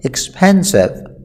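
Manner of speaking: expensive, said very slowly and drawn out for learners; slow model word. Shown as a demonstration. Teacher to students, repeating the word slowly. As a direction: spell out expensive slowly so the class can copy.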